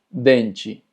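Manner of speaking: dente ending in "chi"; TE pronunciado chi. Shown as a demonstration. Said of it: "T E se pronuncia como chi". (dente con final en «chi»)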